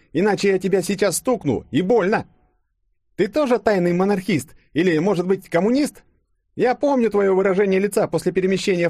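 very high, demanding interrogation; angry Yulia. A narrator's reading. Yulia demanding Denis confess his hidden motives, angrily. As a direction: threaten and grill him, pitched far up.

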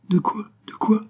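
put-on high, indignant retort of an old ragpicker; hoarse old woman. A narrator's reading. Widow Fipard challenges her haughty son, high and hoarse. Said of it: "fit la veuve Fipard de sa voix enrouée".

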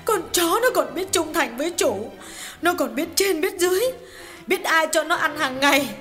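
a hint of sobbing as she bemoans her fate; wife's lightly sobbing complaint; sobbing lament. A narrator's reading. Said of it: "Hà ngồi xuống nức nở tiếp".

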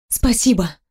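ordinary delivery

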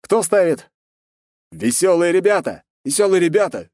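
high loud chanted shouts; shouting. Acted. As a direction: shout your bet over the crowd. Crowd calling out bets.

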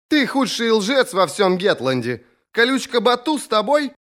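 high-pitched, accusing sister's voice; high, demanding female character voice; exasperated sister. A narrator's reading. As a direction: call it through the door, pitched right up. Sister calling through the door.

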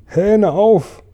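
high, stretched-out call; storyteller's high shout; shrieked command. A narrator's reading. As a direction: yell the order from up in the clouds. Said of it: "schrie sie".

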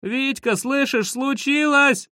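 high-pitched urgent call; high, insistent cry to a friend. A narrator's reading. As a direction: call desperately through a door. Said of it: "закричал я".

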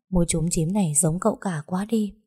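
ordinary delivery

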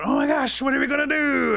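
high, loud wail of panic; frantic storybook shriek; panicked screaming. A narrator's reading. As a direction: scream it in a hammed-up fright. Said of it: "and Otter's screaming, going".

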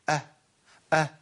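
"أه" with the final ه lost in mere breath; swallowed haa. Retold or imitated. Teacher mimics how many people say it, haa swallowed. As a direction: let the ه of "أه" vanish into a faint puff. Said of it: "ما طلعتش هاء".

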